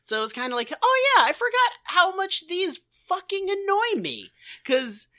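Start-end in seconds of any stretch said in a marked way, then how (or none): none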